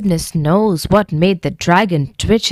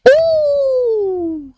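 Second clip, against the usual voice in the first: scared dragon gasp. A narrator's reading. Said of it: "said the dragon".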